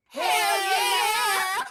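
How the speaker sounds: whooping yell with a laugh; party hype yell; excited shout. Acted.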